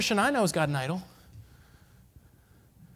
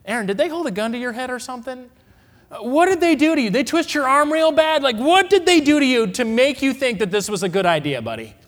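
exasperated Moses; 6 semitones higher, 4 dB louder than usual. Pretend. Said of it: "put that in modern vernacular".

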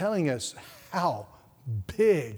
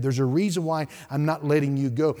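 normal talking voice

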